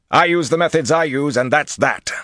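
loud, high, brash tyrant; stubborn swaggering declaration; arrogant lord. A narrator's reading. Tyrant brushing aside advice.

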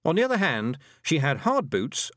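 ordinary delivery, nothing marked

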